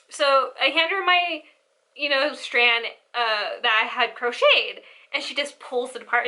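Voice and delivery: ordinary talk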